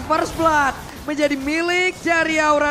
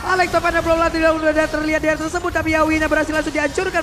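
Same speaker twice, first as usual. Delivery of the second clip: fast, rising shout-casting, louder and higher; live play-by-play; 5 semitones higher, 6 dB louder than usual. Real, not acted. Caster calling the teamfight to viewers, excited play-by-play. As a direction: ride the teamfight call with mounting hype.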